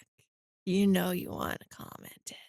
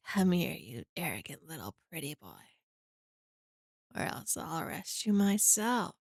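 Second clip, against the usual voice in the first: hammed-up smooching voice; 3 semitones lower, 4 dB quieter than usual. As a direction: lower and softer, an overdone seductive coo. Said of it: "You don't like that voice".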